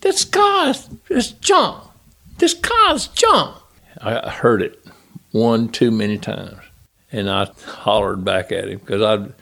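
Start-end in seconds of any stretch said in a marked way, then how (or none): 0.0-3.5 high, whiny mock complaint on the radio; whining driver. Driver whining to crew chief over radio, high mock whine. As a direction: play the driver whining his car is junk. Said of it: "call me on the radio and say".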